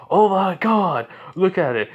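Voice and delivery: loud, high mock astonishment; mocking exclamation. Student ridicules a rival, mockingly loud. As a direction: exclaim it with exaggerated scorn.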